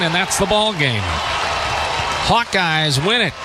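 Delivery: ordinary talk, nothing marked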